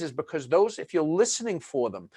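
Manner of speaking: ordinary delivery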